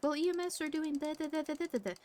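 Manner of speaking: imitating a coworker's endless chatter; mocking yap. Mimicking Hana rattling on at her, mocking. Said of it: "trying to just yap at me".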